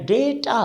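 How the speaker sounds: "data" as DAY-taa; correct pronunciation. Shown as a demonstration. Teacher repeating for learners, "data" with an ay vowel. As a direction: say "data" to rhyme with beta. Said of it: "जैसे हम कहते हैं बेटा".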